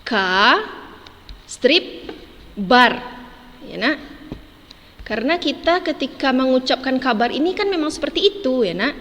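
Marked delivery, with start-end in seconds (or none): 0.1-3.0 Ka, strip, bar said as separate syllables with pauses; teacher breaking the word into syllables; syllables separated. Shown as a demonstration. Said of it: "Nah, ini dia".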